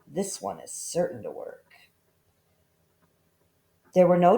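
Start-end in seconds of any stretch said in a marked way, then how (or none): 0.1-1.6 soft scheming murmur; sly to himself. A narrator's reading. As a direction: confide in yourself, scheming. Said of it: "he said to himself".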